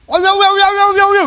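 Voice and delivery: high, loud mock-brawling call; Batak loud talk. Preacher imitating how Batak people talk, loud mock quarrel. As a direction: bark it like a Batak ready to fight. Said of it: "Ngomong saja sudah kayak mau berkelahi".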